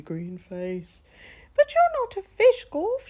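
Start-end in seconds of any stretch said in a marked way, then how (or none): 1.6-3.1 very high, loud squeal; squealing frog. Acted. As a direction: squeal it with laughter in the voice. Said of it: "she squealed".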